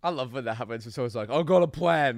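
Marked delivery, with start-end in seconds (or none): 1.3-2.2 loud, raised overconfidence; cocky announcement. Retold or imitated. Mate proposing a dumb idea, cocky announcement. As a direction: burst out with it proudly.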